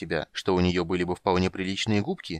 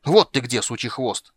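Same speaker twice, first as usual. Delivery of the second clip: loud angry bark at the impostor; 3 semitones higher, 6 dB louder than usual. Acted. Dirty halfling barking at his double at the table. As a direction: roar it, charging in. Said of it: "рявкнул грязный Низушек".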